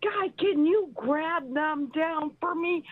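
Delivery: high, sweet put-on voice asking for help; high, sweet pleading request. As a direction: sweeten it up to get a favour. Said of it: "And I'm like".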